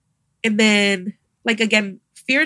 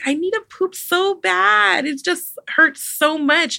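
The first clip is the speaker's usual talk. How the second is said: retold crying; 7 semitones higher, slower than usual. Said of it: "Why are you crying?".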